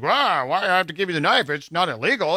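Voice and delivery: a hint of an indignant old man arguing back; protesting knife man.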